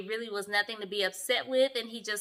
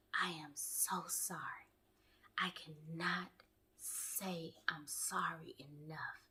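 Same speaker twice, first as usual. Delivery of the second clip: slow, drawn-out whisper imitating an apologizer; mock whispered apology; 7 semitones lower, 16 dB quieter, slower than usual. Chris apologizing to Paige, imitated in a slow whisper. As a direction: whisper it slowly, as if overdoing the sorry.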